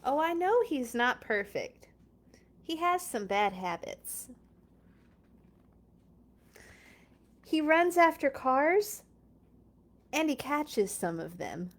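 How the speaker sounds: lively storybook read-aloud; reading to children. Acted. Reading Clifford aloud to viewers, bright storybook voice. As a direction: read it like story time for little kids. Reads picture book aloud.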